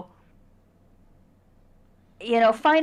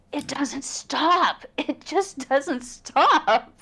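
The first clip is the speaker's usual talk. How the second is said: high, drawn-out exasperation with a laugh; exasperated; 7 semitones higher, much slower than usual. Real, not acted. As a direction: stretch it out slowly, laughing in despair. Venting about endless project planning.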